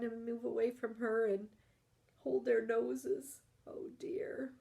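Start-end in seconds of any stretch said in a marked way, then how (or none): 3.7-4.5 whisper. Acted.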